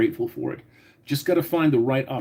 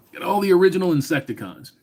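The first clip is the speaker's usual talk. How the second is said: higher, faster, excited repeat of the comment; impressed repeat; 7 semitones higher, faster than usual. Real, not acted. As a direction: say it again like you can't believe it.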